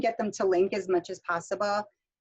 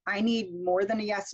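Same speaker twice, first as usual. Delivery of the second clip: ordinary delivery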